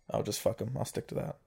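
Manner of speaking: ordinary talk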